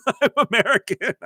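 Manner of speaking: bursts into a laugh, pitch jumping way up. Real, not acted. Laughing at a client who wants no American sound. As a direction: laugh it out in disbelief.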